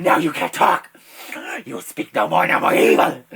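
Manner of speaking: loud, forceful shouting; unhinged full-volume outburst; deranged triumph. Acted. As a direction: declare victory in a crazed yell. [name] gloats over silenced puppet.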